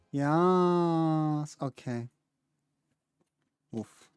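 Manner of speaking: normal talking voice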